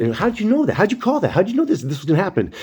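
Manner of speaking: high-pitched mock astonishment; amazed onlookers. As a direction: imitate stunned friends firing questions way up high. Retelling amazed onlookers' questions.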